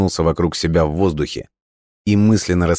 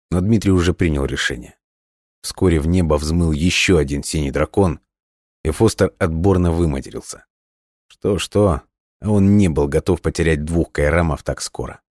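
usual speaking voice

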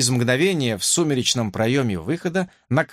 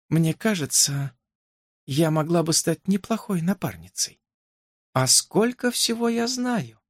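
higher dreamy princess voice; 6 semitones higher than usual. A narrator's reading. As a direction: drift off into daydreams. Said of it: "В голосе принцессы скользнули мечтательные нотки".

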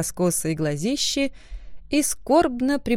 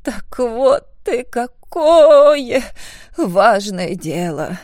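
breathy, slow and much louder; 3 semitones higher, 6 dB louder, much slower than usual. A narrator's reading. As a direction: gasp it out in awe, drawn out. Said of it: "с придыханием добавил".

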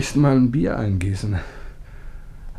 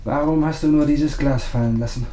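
ordinary talk

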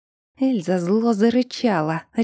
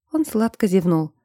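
ordinary delivery

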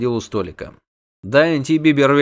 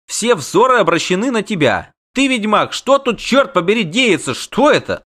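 high, insistent questioning; bewildered demand; 7 semitones higher, 4 dB louder than usual. A narrator's reading. Bard demands the witcher explain the creature, bewildered. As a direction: press for answers, voice climbing high.

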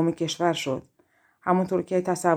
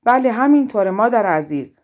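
loud, projected agreement; ringing reply to someone far off; calling back; 3 semitones higher, 7 dB louder than usual. A narrator's reading. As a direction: answer boldly across the water. Pinkel calls back across lake.

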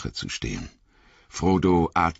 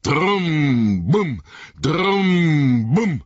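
loud, higher booming drumbeats; loud pounding drums; 9 semitones higher, 5 dB louder, much slower than usual. A narrator's reading. The drums gather pace, loud rhythmic booms. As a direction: drums picking up speed, booming. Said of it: "Die Trommeln kamen in Fahrt".

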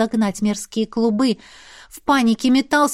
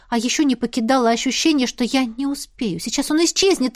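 usual speaking voice

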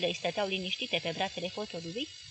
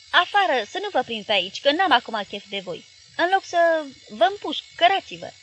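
much louder and higher, raging throughout; furious roar; 6 semitones higher, 9 dB louder than usual. Acted. Journalist threatening his parents-in-law in the doorway, furious roar. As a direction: blast them out of the doorway. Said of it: "răcni el".

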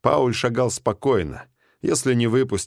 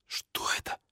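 a breathy whisper in awe; frightened whispered question; 12 dB quieter than usual. Acted. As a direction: whisper it, barely voiced. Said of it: "прошептал биолог".

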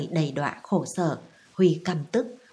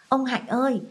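put-on higher-pitched narrated child's call; 7 semitones higher than usual. A narrator's reading. Child calls the old servant after being hugged too tight, calling out. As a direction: call for help without really shouting. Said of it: "kêu to".

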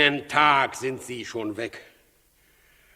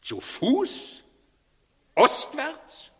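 much higher, rising outraged cries; outraged disbelief; 9 semitones higher than usual. Acted. Herodes hearing the magi fled east, outraged disbelief. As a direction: shriek each question in disbelief.

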